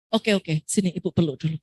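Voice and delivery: calm him with a gentle hug offer; mother easing a tantrum, soft and low; soothing mother. Retold or imitated. Mother soothing an angry child.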